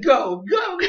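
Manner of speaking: high-pitched eager urging; mock urging. Chespin pushing his rival out, eagerly urging. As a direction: act out hurrying someone off.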